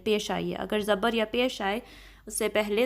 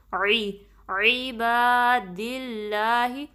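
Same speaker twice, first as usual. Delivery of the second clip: very slow, drawn-out recitation of the greeting; much slower than usual. Shown as a demonstration. Teacher reciting the phrase for students to repeat. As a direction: stretch each vowel in a measured chant. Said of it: "درمیانے حلق کے حصے سے نکالیں".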